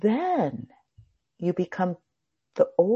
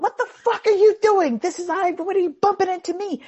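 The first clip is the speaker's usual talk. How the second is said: much higher, louder angry yell; mock yelling; 11 semitones higher, 5 dB louder than usual. Boaters yell at the person who bumped them, angry. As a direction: yell at the boat that hit you. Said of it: "They start yelling".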